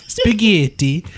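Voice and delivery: high-pitched mock Italian accent; Italian chef's accent. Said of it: "with her accent, the way she says".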